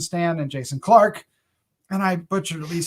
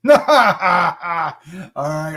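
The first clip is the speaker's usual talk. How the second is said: usual speaking voice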